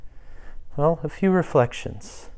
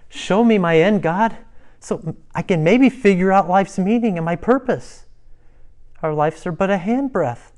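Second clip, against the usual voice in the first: raised, higher voice in earnest appeal; David crying out; 6 semitones higher, 4 dB louder than usual. Acted. Preacher voices David pleading with God about life's end, crying out. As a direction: cry out to God. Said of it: "That's why David cries out".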